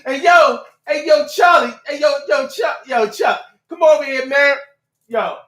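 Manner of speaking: imitating a loud guy hollering at his buddy; loud coworker voice. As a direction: call out to Chuck like a loud coworker. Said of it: "they have the loud voice like that".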